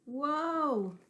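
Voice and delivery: high, startled whoa; slipping surprise. Acted. Egg slipping on the floor, startled whoa. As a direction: yelp as your feet slide out.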